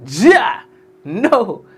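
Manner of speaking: loud, high two-word shout; shouted catchphrase. Real, not acted. Host calls the sign-off to the audience, shouting. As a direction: belt out the sign-off, punching each word.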